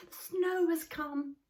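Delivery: put-on small eager child's voice, slow and high. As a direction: say it like a wide-eyed little troll. Moomin Troll voiced, high.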